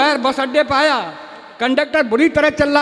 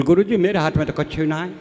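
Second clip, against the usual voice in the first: put-on dim village boy; 9 semitones lower, 3 dB quieter than usual. Boy protests to his teacher in a deep rustic voice.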